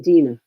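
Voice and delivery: Dina said as DEE-nah, with an ee sound; the other way people say it; Dina pronunciation. Shown as a demonstration. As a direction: say Dina with a long e, DEE-nuh. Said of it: "what's the other way they say?".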